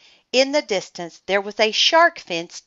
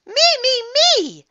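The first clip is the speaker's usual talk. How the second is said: high, loud, begging shout; eager shout; 7 dB louder than usual. A narrator's reading. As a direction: shout to be picked, much higher and louder. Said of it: "shouted Andrea".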